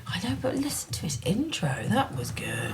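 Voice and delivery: hushed aside behind his back; whispered aside. Real, not acted. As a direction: whisper so he can't hear. Whispered aside about co-host.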